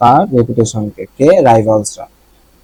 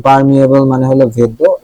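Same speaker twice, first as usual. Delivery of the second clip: ordinary delivery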